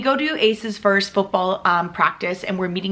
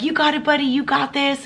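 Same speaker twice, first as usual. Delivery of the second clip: put-on higher, upbeat cheer for a kid; root him on from the sideline; hint of a sideline mom chanting encouragement; 6 semitones higher than usual. Mom cheering son at practice.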